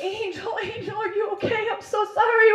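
high-pitched mock weepy fussing; tearful concern. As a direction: fuss over a friend, pretend crying. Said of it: "We're, like, crying".

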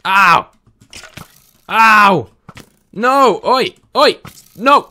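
loud, high yelps of pain and protest. Real, not acted. Player under attack yelps and shouts in pain. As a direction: yelp as the mobs hit you.

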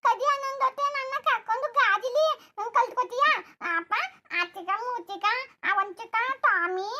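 put-on high, pleading little-girl voice; childish daughter. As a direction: beg like an excited child to go to the fair.

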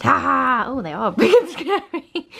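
giggling through the words; laughing. Real, not acted. Laughing at the puppy's big teeth. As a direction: laugh as you say it.

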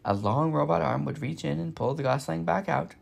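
plain reading voice

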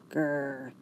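low soft growl. A narrator's reading. Rosie growls at Maurice, low and soft. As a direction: rumble a warning growl. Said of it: "Rosie growls".

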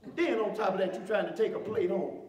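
loud, hyped-up sermon voice; fiery preaching. Acted. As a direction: preach it hard to the congregation. Preaching at full force.

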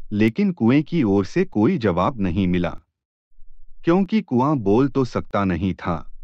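plain reading voice

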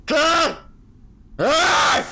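loud, high scream; startled scream. Real, not acted. Going down the dark well, he screams loud and high. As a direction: scream in sudden fright.

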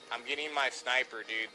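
ordinary talk

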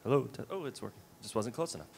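normal talking voice

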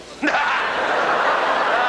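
big scornful laugh. Real, not acted. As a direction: burst out laughing in disbelief. Laughing at the lunatic fringe.

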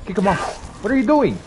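normal talking voice

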